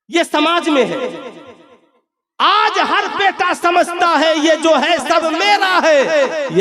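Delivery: high, slow declamation; drawn-out, ringing sermon cry; full-force preaching. Acted. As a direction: proclaim it slowly to the crowd, pitched high.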